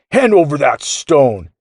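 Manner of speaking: loud, high-pitched booming order; booming echo. A narrator's reading. Gear reminds the boy, booming. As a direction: bellow it like a giant echo. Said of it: "echoed again".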